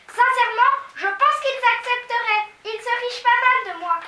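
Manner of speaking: high, loud excited cry; excited high girl's cry; exclaiming. A narrator's reading. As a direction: burst out with hope. Mathilda excited on.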